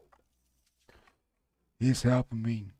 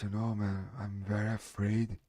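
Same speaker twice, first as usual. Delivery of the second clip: ordinary talk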